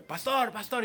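high-pitched retold calling out; mock yell. Groom hollers for his pastor before the wedding, mock yell. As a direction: call urgently, high, without full volume. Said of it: "vuelve a gritarme".

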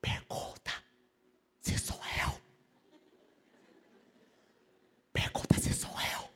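unvoiced, urgent whisper; secret whisper. Peter begging John in secret, whispered. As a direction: lean in and whisper the plea.

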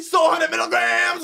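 high, sassy impression of a friend; sassy Kevin voice. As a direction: mimic him high-pitched with a wiggle. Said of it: "That's how he said it".

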